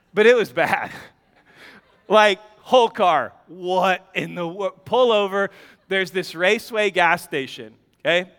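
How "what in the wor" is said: normal talking voice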